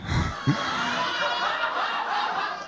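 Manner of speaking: a real high breathy laugh that bursts out; burst of laughter. Real, not acted. Reader breaks up at the awkward question, real laughter. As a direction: crack up helplessly mid-read.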